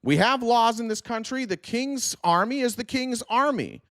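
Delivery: a hammed-up Tory telling rebels to leave; mock loyal colonist. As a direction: whine out the king's loyalist. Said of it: "would be like".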